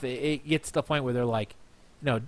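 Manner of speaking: normal talking voice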